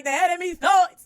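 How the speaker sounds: high, loud jubilant praise. Real, not acted. Celebrating the fax arriving, loud jubilant praise. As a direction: shout it like church praise.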